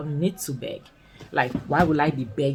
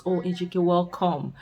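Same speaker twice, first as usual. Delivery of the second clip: higher, gushing greeting to the returning rich man; mock warm welcome; 3 semitones higher than usual. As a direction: fawn over him like a long-lost hero. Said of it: "they just have to welcome him with open arms".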